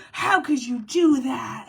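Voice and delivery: slow, fake-innocent whine; hammed-up offended voice; mock hurt whine. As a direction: imitate someone acting wounded, drawn out. Mimicking people's fake hurt.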